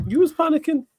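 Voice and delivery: high-pitched, affronted comeback; incredulous echo. Real, not acted. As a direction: throw the accusation back in disbelief, voice shooting up. Indignant echo of co-host's accusation.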